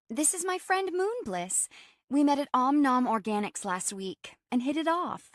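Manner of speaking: high-pitched, excited introduction; bubbly friend. Acted. Friend proudly introduces her new pal to her housemate, bubbly. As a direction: chirp it high like an eager friend.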